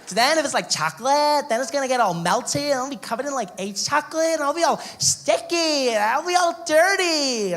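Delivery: put-on high, whiny American girl; whiny valley girl. Girl refuses the chocolate idea, high whiny voice. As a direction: whine about being sticky and dirty. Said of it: "She's like".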